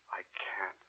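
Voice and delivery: unvoiced despairing whisper; hushed, broken admission. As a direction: barely breathe the refusal. Confessing forbidden love.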